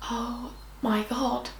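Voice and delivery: slow, stunned whisper; very soft, drawn-out shock; hushed shock. Retold or imitated. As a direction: breathe it out slowly in disbelief. Reacting to learning of betrayal.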